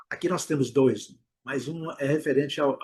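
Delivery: ordinary talk, nothing marked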